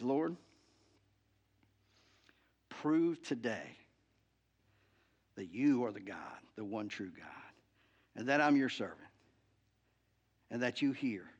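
hushed reverent prayer of Elijah; solemn prayer. Acted. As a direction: pray it quietly in awe. Elijah prays before the altar.

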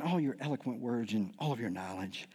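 low, soft mock admiration; butter them up quietly, with sly sarcasm; sarcastic flattery. Paul feeding the church's ego, softly sarcastic. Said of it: "That's why he's saying".

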